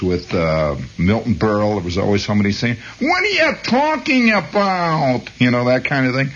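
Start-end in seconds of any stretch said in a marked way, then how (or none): 3.0-5.3 much higher, slow Brooklyn woman's complaint; Brooklyn radio accent. Retold or imitated. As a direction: do the old radio Brooklyn dame. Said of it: "women that used to do all kinds of Brooklyn accents".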